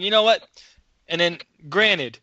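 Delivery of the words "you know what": high, loud you know what; heated challenge. Retold or imitated. He squares up to three coworkers, heated and loud. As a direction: wind up to tell them off. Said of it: "I'm like".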